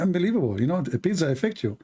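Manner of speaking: ordinary talk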